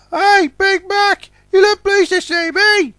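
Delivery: bright chirpy little engine greeting; cheery little train. Acted. Puffer greets Big Mac, very high and cheerful. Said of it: "Puffer said cheerily".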